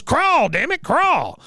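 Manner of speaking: high-pitched drill-command impression; mock battlefield yell from an old veteran; Cotton. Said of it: "He goes".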